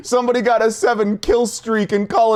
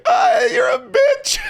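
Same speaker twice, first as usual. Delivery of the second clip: laughing mock insult. Real, not acted. Teasing friend through laughter.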